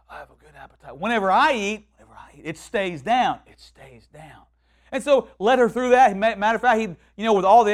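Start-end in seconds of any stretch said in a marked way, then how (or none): 0.1-1.0 very soft, low imitation of a frail woman; weak repeating. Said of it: "So she". 2.0-2.4 hushed imitation of a frail woman echoing; weak repeating. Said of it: "So she". 3.5-4.4 very soft, low feeble echo; weak repeating. Retold or imitated. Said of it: "So she".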